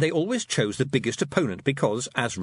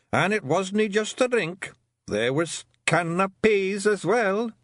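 slow, higher Scots pixie; savouring the memory; Daft Willie's voice; 8 semitones higher, slower than usual. A narrator's reading. Daft Willie telling Tiffany about the party, fond Scots voice.

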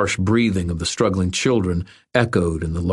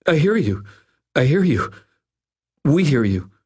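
high-pitched, anxious repetition; cowed agreement; 8 semitones higher than usual. A narrator's reading. Dangling bully yielding to his captor, high and cowed. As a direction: plead that the message got through, voice pitched up.

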